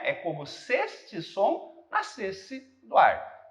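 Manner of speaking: "É como se este som nascesse do ar" slowly, in grouped beats; "É como se este som nascesse do ar" measured out slowly, "do ar" as one pulse; scansion with linked syllables. Shown as a demonstration. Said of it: "você junta aqui".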